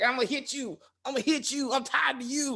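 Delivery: high, whiny mock threat; squeaky taunting play-fight voice; mocking squabbling teenager. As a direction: mimic a kid fake-threatening in a scuffle. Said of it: "Why you acting like".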